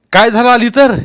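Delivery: high, loud demand; challenging retort. A narrator's reading. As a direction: throw the complaint back forcefully. Elder challenges the boys' complaint.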